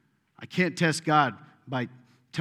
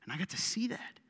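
whisper; 2 semitones higher, 9 dB quieter than usual. Real, not acted. Sermon, intimate aside.

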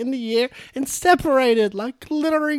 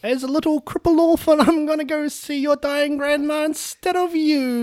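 put-on higher, cheeky street-kid voice; little orphan boy; 3 semitones higher than usual. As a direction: play a scrappy little boy. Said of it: "I'm a little orphan boy".

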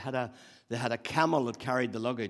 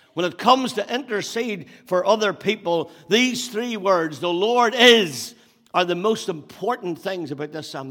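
loud, higher, building preaching with every "The Lord is" punched; preaching at full force; 6 semitones higher, 10 dB louder than usual. Acted. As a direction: proclaim it to the whole church.

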